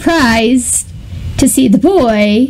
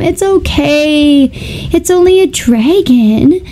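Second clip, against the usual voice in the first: lilting, higher voice making light of it; unbothered boy; 4 semitones higher than usual. Retold or imitated. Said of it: "the boy tells him".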